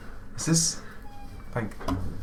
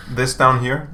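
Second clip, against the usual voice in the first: ordinary talk, nothing marked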